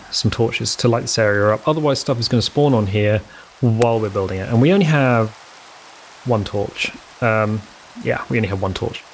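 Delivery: ordinary delivery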